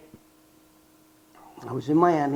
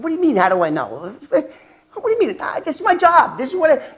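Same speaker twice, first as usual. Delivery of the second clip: indignant; 10 semitones higher, 5 dB louder, faster than usual. Retold or imitated. Narrator defending himself to agent.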